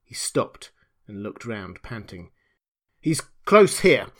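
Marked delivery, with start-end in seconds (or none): 3.0-4.1 raised, loud warning; urgent cry. A narrator's reading. Kemp warning the pursuers, urgent cry. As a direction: cry out to rally the others. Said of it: "he cried".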